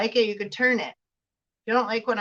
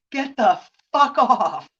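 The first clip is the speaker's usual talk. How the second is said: mock firm; 4 dB louder than usual. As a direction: say it slow and done with it. Acted shove-off, firmer.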